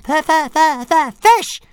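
very high, loud stuttering squawk; demanding little dragon. A narrator's reading. Dragon bites his master for food, shrill stuttered demand. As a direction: stammer then burst onto the last word. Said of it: "said Toothless again".

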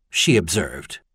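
usual speaking voice

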